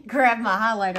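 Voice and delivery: breathless after running; panting a little between words; out of breath. Real, not acted. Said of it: "I'm out of breath".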